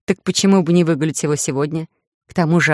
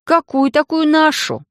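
high, slow, indignant question; 11 semitones higher, slower than usual. A narrator's reading. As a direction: protest it, pitched way up. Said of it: "возмутилась я". (возмущённо, высоко)

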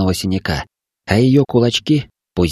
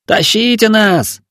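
high, slow, loud call; shouting; 12 semitones higher, 3 dB louder, slower than usual. Acted. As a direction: shout the order, drawn out. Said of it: "снова крикнули".

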